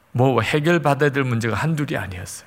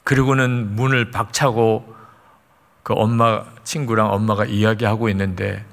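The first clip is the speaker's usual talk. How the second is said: ordinary talk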